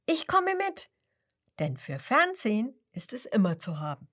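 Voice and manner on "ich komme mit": much higher, delighted cry; excited. Acted. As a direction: squeal it with joy. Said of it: "ruft das Schwein begeistert". (begeistert, hoch gerufen)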